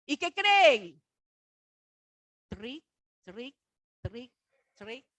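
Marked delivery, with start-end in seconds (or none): 2.5-5.1 machine noise. Retold or imitated. Imitating the cash machine.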